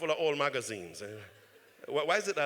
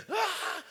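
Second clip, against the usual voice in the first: high pained wail. Retold or imitated. Scene of burning agony.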